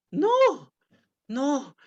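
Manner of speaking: high, fast pleading she-wolf. Acted. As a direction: squeak it out in a rush.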